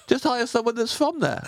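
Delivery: high-pitched indignant questioning; mock complaint. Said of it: "put on an accent for a character and go".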